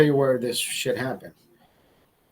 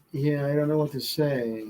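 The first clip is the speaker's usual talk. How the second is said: Biden-like; 6 dB quieter than usual. Retold or imitated. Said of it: "he just turns into fucking Biden".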